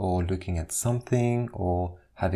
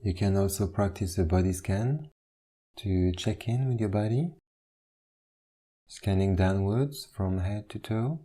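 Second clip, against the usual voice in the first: guided meditation. Acted. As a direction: ease them into stillness, one instruction at a time. Leads a standing meditation.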